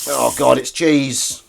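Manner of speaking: real disgust at finding cheese; disgusted discovery. Real, not acted. Finds cheese in the sink.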